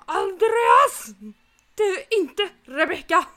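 loud high yell through laughter; laughing, loud alarmed yell; shocked shout. Retold or imitated. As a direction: shriek it in morning shock at finding the boyfriend. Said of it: "Moa på morgonen och bara".

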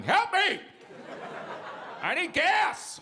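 high, drawn-out mock scream; old man screaming. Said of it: "an old man screaming at the gas pump".

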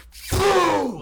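a loud, high fake sneeze blast; mock huge sneeze. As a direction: burst out a hammed-up sneeze. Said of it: "like, sneezes so hard".

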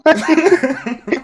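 high-pitched real laugh; laughter. Real, not acted. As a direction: crack up at your own joke. Laughs at own joke. (risada aguda)

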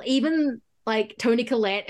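ordinary talk, nothing marked

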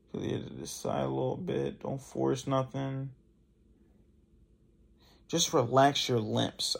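relaxed. Shown as a demonstration. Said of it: "Relax everything".

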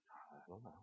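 put-on hushed, low, faint voice of a forgetful old woman; barely there, lost and unsure; frail grandmother. Grandmother who had a stroke answers her grandson, faint and low.

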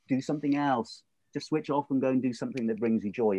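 usual speaking voice

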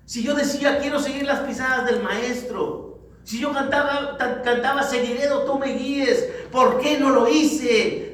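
high, loud self-reproach; autorreproche. Acted. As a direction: preach it as rising, anguished regret at yourself. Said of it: "Te vas a estar reprochando". (autorreproche en voz alta y aguda)

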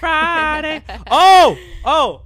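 high panicked shriek; screaming. Real, not acted. Hot pepper strawberry panic screams.

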